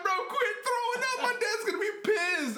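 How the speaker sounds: very high, mock panicked friend; panicky friend. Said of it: "he was like".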